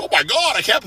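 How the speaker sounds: high-pitched mock whining of a scared kid; whiny pansy. Lifter mocking kids who quit hard training, high whiny voice. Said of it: "like, little kids and pansies and stuff".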